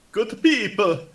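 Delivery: loud, high hail to a crowd; in-character persuasion. Acted. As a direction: call out grandly to the townsfolk in an accented character voice. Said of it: "roleplay that out then".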